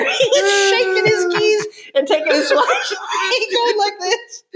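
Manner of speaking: high, crying with laughter; real tears of laughter; laughing hard. Real, not acted. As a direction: barely get it out through laughing. Helpless laughter retelling prank.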